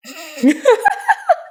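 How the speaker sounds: a sudden high laugh breaking out; burst of laughter. Real, not acted. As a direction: laugh out loud, high and free. Player laughs at the dialogue.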